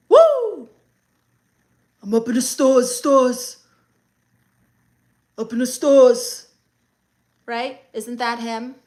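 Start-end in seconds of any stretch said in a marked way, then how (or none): 0.1-0.7 loud high rapper ad-lib yell; hyped drill ad-lib woo. Shown as a demonstration. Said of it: "Do a [name] accent". 2.0-6.5 louder Brooklyn drill rapper voice, heavy and rough. Shown as a demonstration. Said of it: "Do a [name] accent".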